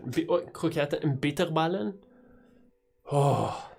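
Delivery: normal talking voice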